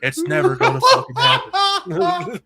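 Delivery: capping the Nick Cage impression with a manic yell; unhinged Nick Cage. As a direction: explode into a wild Nick Cage climax. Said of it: "Nick Cage".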